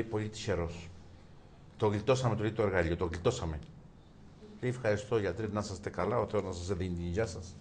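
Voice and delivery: quiet, gentle delivery; soft. Acted. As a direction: speak it softly and calm. Doctor and patient softly speak. (ήσυχα και ήρεμα)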